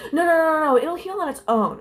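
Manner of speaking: high, loud, rapid-fire no's; mock a flustered nurse's hurried reassurance; imitated freaking-out nurse. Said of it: "freaking out".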